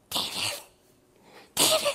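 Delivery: gasp, then whisper the name; a startled, secretive hiss of a name; hushed urgent call. Acted. Abishai to David in the sleeping camp, hushed urgent call.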